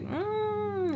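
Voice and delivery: high, soft disapproving "mm"; mocking hum. Mimicking disapproving woman.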